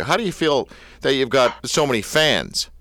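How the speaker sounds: ordinary delivery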